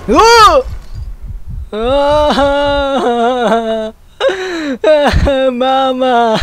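high, loud drawn-out shriek breaking into wailing laughter; real piercing horror-game scream; long terrified scream. Real, not acted. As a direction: scream at a jump scare, then laugh-cry. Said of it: "叫びすぎたというか".

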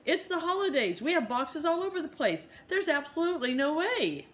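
high, insistent and fed up; Mama refusing the landlord on the phone, exasperated; exasperated mom. Acted. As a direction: push back hard on the landlord. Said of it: "Mama barked into her cell phone".

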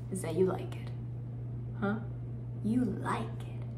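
soft, voiceless needling; whispered taunt. Acted. Woman accuses friend of liking it, whispered. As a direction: whisper it as a cruel provocation.

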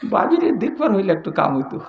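softly marvelling under the breath; thinking to himself. Acted. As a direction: play a man's private smitten thought. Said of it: "মনে মনে কয়".

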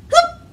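very high, loud, quick whoop. Shown as a demonstration. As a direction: blurt it out in a flash. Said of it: "as fast as we can".

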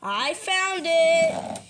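slow, loud, drawn-out announcement; big stretched pretend cheer; triumphant call. Calls out on finding the ball.

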